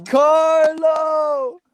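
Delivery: high, drawn-out happy holler of a name; delighted, stretched-out cheer; excited greeting. Real, not acted. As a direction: call out to a friend, voice rising. Greeting friend joining the call.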